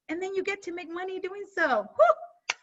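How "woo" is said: high, loud cheering whoop; excited whoop. Real, not acted. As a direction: let out a hype cheer. Cheering herself and the group.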